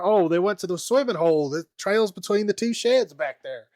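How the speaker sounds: imitating someone with a strong Southern twang; Franklin's Southern accent. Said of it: "you doing the Southern voice for Franklin".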